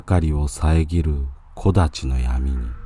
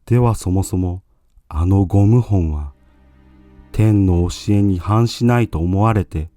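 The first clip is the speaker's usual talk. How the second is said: urgent pleading monk; 3 semitones higher than usual. Acted. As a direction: press him with tearful urgency.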